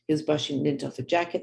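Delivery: ordinary talk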